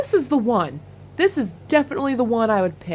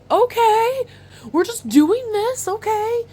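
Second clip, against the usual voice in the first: much higher, amused disbelief; a rising okay of mock disbelief; amazed okay; 13 semitones higher, slower than usual. Reacting to the bassist's tricks, high and amazed.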